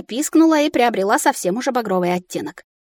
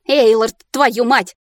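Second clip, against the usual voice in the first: высокая сердитая ругань с мычанием; ругайся, глотая бранные слова; разозлившись; 7 semitones higher, 5 dB louder than usual. A narrator's reading. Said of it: "разозлилась я". (зло, срываясь на мычание)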